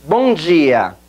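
BOM DIA as "bõ JEE-ah"; Portuguese pronunciation. Shown as a demonstration. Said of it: "ahora hagamos el backtracking".

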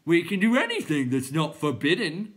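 high, loud clown voice; crying out. A narrator's reading. Clown defying Bastian, high loud cry. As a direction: cry it out defiantly as a silly creature. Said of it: "cried another".

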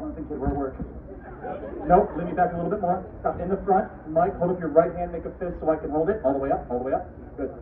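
ordinary talk

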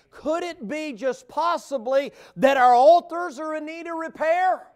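high, loud, slow pulpit cry; full-force preaching. Acted. Preacher pleading with the church about broken altars, full-force preaching.

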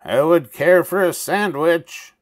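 high loud call, nearer now. A narrator's reading. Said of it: "closer and louder this time".

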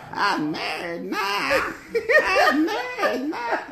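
drawn-out high grumble; slow high drawl. Retold or imitated. Quoting movie line.

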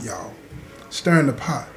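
normal talking voice

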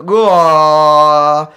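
long, loud retching bellow; sonic vomit. Retold or imitated. Filling the whole room, long loud roar. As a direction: bellow it out, held and open. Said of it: "filled with this sound".

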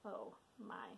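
breathless whispered relief. Real, not acted. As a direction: whisper it, exhausted. After silly sounds.

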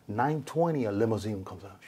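ordinary talk, nothing marked

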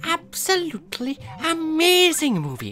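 put-on high, sing-song Welsh accent. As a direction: do a chirpy Welsh local. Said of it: "I love the way the Welsh sounds".

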